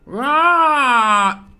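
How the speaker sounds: long, loud high "aah" held out; projected goal cry. Shown as a demonstration. Showing how Mariano Clos shouts goals, projected long cry. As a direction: belt out a long placed-voice commentator cry. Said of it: "está impostando la voz".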